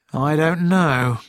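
slow, heavy and downcast; gloomily. A narrator's reading. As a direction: say it like the case weighs on you. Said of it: "said Kelsey gloomily".

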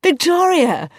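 high, loud, slow gasp of surprise; stunned to see her there; can't believe his eyes; astonished. A narrator's reading. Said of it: "complete surprise appeared on his face".

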